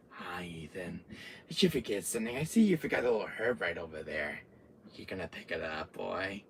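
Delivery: low, soft villain voice; quiet, toying menace; creepy taunt. Retold or imitated. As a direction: murmur it with false friendliness. Villain taunts about forgotten herb.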